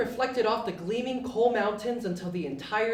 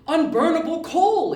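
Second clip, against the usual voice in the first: louder, higher eureka cry; excited exclaim; 7 semitones higher, 8 dB louder than usual. Acted. Yubi shouting his idea to his friends. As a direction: blurt out the discovery. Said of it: "he exclaimed".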